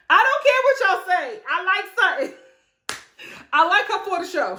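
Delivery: high, emphatic declaration; defiant. Real, not acted. Fan defending Sutton to the viewers, defiant. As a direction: insist on it against all objectors.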